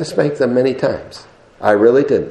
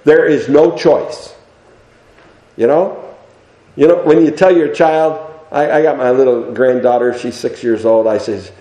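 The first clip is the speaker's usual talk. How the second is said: usual speaking voice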